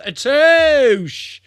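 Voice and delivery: a high, loud cry of delight; excited exclamation. Real, not acted. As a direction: shout it out, thrilled.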